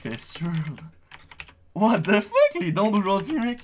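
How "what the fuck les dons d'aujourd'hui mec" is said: shocked. Real, not acted. Shocked by donations.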